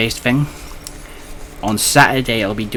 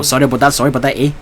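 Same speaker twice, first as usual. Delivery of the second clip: lightly imitated Canadian, higher and a touch louder; playful Canadian stock-phrase imitation; mock Canadian accent; 4 semitones higher, 4 dB louder, much faster than usual. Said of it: "All I know about Canadians is they go".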